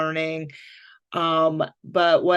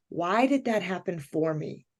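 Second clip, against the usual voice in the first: inner question; 7 dB quieter than usual. Retold or imitated. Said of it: "And I thought".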